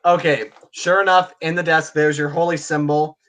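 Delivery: normal talking voice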